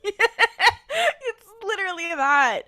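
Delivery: high, loud, helpless laughter; laughing. Real, not acted. Agreeing with a friend's silly title joke, laughing. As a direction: squeal it out slowly through real laughter.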